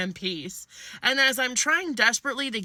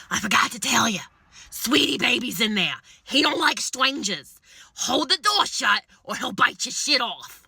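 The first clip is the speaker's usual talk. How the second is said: homeowner's voice; 3 semitones higher than usual. Retold or imitated. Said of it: "all I hear is".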